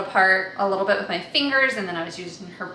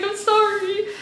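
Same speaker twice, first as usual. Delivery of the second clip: tearful apology; 13 semitones higher, 6 dB louder than usual. Retold or imitated. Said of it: "I'm like".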